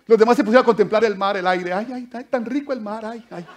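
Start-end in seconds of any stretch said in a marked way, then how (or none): 1.7-2.2 dreamy sighing. Retold or imitated. Disciples lazily admiring sea. 2.2-3.1 soft, laughing, savouring the words tan rico el mar; dreamy mock delight. Preacher mocking disciples admiring the sea, dreamy and soft. 3.1-3.5 dreamy sighing. Retold or imitated. Disciples lazily admiring sea.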